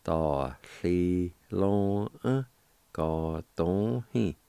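"da li long e, ga dong he" said slowly and clearly; "da li long e, ga dong he" drawn out syllable by syllable; slow careful pronunciation. Shown as a demonstration. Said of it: "And together".

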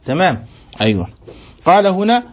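ordinary talk

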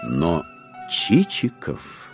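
ordinary talk

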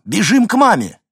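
put-on high, loud call to children; urgent shout. A narrator's reading. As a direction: shout back to them on the run. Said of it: "крикнул он, обернувшись на бегу". (громкий крик на бегу)

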